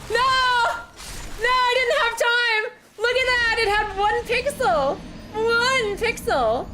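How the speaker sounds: very high, loud, drawn-out lament; anguished gamer wail. Real, not acted. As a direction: wail slowly at a narrow loss. Anguished at losing by one pixel.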